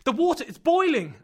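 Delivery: very high and loud alarmed yell; shouted. Acted. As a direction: cry out the danger. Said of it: "I shouted".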